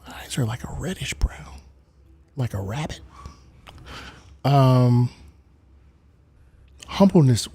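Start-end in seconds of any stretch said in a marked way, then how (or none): 0.1-3.0 hushed, unvoiced whisper. Streamer describing his eyes to viewers, whispering. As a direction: whisper it very softly.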